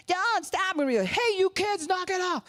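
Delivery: high, loud scolding shout; mock yell at kids. Parent shouting at a small child, high loud scold.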